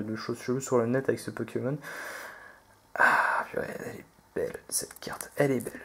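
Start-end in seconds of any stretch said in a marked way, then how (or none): 3.0-5.8 hushed, voiceless admiration; awed whisper. Real, not acted. Collector admiring his rare card to himself, awed whisper.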